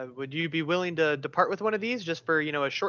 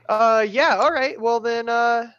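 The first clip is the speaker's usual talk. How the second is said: loud, higher, covering for a slip; awkward cover-up; 6 semitones higher, 10 dB louder, slower than usual. Acted. Player covering up to the mage, loud and awkward. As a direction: stall loudly after a whispered aside. Covering up after whispered aside.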